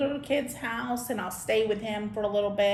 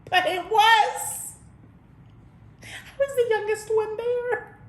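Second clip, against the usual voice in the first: indignant surprise; 10 semitones higher, 4 dB louder than usual. Real, not acted. Indignant punchline.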